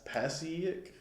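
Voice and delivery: Pasiak tried as PASS-ee-ick, rising and soft; unsure pronunciation. Real, not acted. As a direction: sound out Pasiak with a short A, as a question. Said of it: "I don't know this word right".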